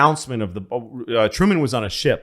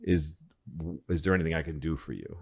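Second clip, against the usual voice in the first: much softer, hushed courtesy; soft polite quote; 5 semitones lower, 8 dB quieter than usual. Retold or imitated. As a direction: murmur it politely at the door. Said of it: "And he said".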